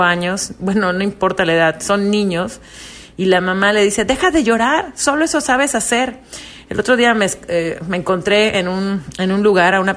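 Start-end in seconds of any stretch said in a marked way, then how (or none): 4.0-6.2 high, sharp scolding mother; impatient mom telling her child to stop crying. Retold or imitated.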